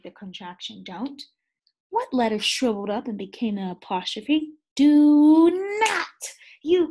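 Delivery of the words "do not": high, loud, punched do not; emphatic answer. Shown as a demonstration. Teacher gives the answer to the contraction quiz, loud. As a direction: hit both words clearly.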